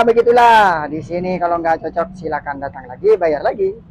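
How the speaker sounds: ordinary talk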